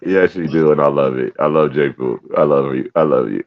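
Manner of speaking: calm her down tenderly. Real, not acted. Soothing partner after she vents.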